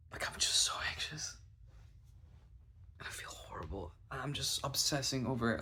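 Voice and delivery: voiceless, strained confession; anxious whisper. Retold or imitated. Confessing obsession after the meeting, anxious whisper. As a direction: whisper it as if drained by worry.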